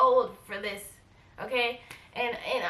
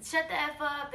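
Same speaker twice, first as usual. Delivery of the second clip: higher-pitched mock outrage; imitating friend going crazy; 5 semitones higher than usual. Imitating the friend refusing to shut up, higher mock outrage. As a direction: put on an indignant friend, pitched up. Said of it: "she starts going all crazy".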